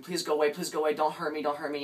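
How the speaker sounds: acted frightened cry, short of a full scream; screaming plea. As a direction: beg loudly, high and urgent. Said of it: "she's like, you know, screaming".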